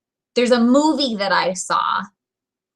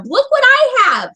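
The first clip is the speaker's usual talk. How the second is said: high, loud proud reveal; eager shout; 5 dB louder than usual. Retold or imitated. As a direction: thrust it at him with glee. Shoving warmer into truck window.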